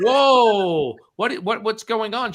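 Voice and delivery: loud high whoa, then stammered question; startled reaction. Retold or imitated. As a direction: react in acted alarm. His startled reply, acted.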